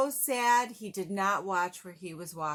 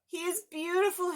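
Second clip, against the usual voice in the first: higher, dreamy praise; lightly voiced child; 10 semitones higher than usual. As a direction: gush it like a proud little boy. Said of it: "Harry said".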